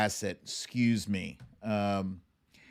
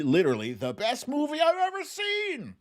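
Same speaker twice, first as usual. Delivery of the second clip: much higher, gushing movie fan; raving fan; 13 semitones higher than usual. Retold or imitated. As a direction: rave like it changed your life. Viewer raving about favorite movie.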